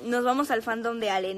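normal talking voice

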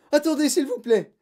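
high, loud, frantic pleading; desperate schoolboy; pleading cry. A narrator's reading. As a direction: beg for time like a panicked pupil. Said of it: "cria-t-il".

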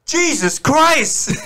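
high, slow stunned gasp of a name; shocked exclamation. Acted. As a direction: blurt it out in disbelief. Meets Jesus through portal.

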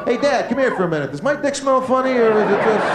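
put-on high, fast boyish whine; whiny son. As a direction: son nagging his father in a high, rushed voice. Son voiced asking dad.

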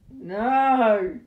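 loud, protesting cry; indignant shout. Pretend. Friend protests at the unwelcome visitor, indignant shout. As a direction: shout out a refusal.

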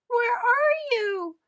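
put-on very high, slow squeaky baby bat cry; squeaked. A narrator's reading. Lost baby bat searches for mother, squeaking high. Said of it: "Stella Luna squeaked".

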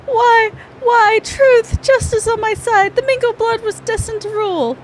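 unhinged villain. Acted. Villain's shrieking downfall.

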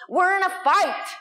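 high-pitched urgent rallying cry; dramatic, high alarmist headline; all-caps alarm. Acted. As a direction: sound the alarm from a subject line. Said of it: "All caps, exclamation point".

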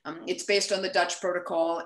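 usual speaking voice

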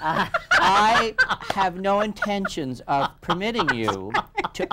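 Tsongas impression. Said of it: "Zongas turned around and said".

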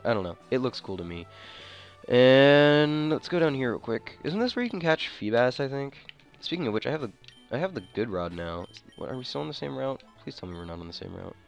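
ordinary talk

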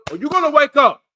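high, loud shouted challenge; shouting. Real, not acted. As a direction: yell it at them to wake up.

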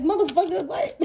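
sudden laughing "what?" at the news; shocked outburst. Retold or imitated. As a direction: blurt it out half-laughing in shock. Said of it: "And you like".

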